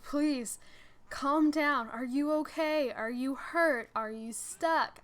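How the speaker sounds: imitating a worried dad, loud and insistent; retold urgent pleading. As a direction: press each question loudly, drawing out the last. Retold worried dad questioning her.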